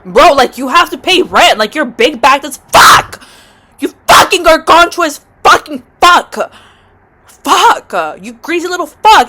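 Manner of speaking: loud, high, drawn-out insults; hammed-up furious yelling; berating a roach. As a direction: hurl exasperated abuse at an intruder.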